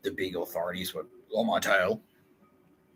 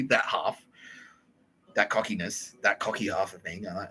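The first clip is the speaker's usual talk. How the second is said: ordinary talk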